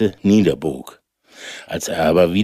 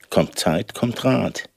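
ordinary delivery

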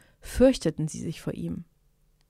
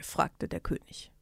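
ordinary delivery

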